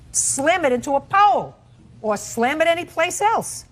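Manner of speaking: rising scolding emphasis; indignant scolding. Real, not acted. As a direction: hammer the word slam with indignation. Judge scolding about damaged car.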